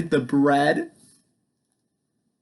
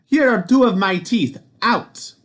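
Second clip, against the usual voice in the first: higher, loud outraged roar; angry giant; 7 semitones higher, 3 dB louder than usual. A narrator's reading. Giant losing teeth, loud outraged shout. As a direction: spit out the teeth in fury. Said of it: "shouted Cuchulainn".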